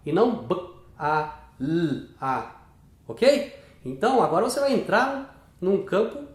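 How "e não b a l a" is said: "b, a, l, a" said as separate sounds; spelling out sounds. Shown as a demonstration. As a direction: break "bala" into its single sounds, one by one. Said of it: "Elas falam bala". ("b, a, l, a" som por som, separado)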